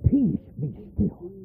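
slow, hushed "Peace, be still"; soft calming command. Shown as a demonstration. Preacher echoing Jesus, soft slow calm. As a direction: settle the storm softly. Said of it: "repeated command, slower and softer".

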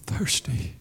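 dropped to a near hush; a hushed, dry echo of thirsty; soft echo. Acted. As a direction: let the word fall away quietly. Preacher echoes the word softly.

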